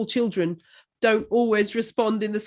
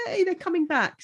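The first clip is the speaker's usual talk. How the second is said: excited; 9 semitones higher than usual. Retold or imitated. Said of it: "So I was like".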